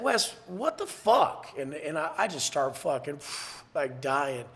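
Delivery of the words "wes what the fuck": high, slow mock dismay; imitating dismayed mother. Mother reacting to her son's new case, imitated dismay. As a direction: draw it out high, as an exasperated mother.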